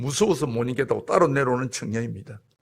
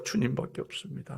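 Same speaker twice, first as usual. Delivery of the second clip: 주님밖에 없습니다 breathed as a soft whisper, no voice; whispered echo; 10 dB quieter than usual. Acted. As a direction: echo the prayer under your breath. Repeated prayer, second take whispered.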